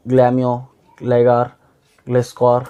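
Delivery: ordinary talk, nothing marked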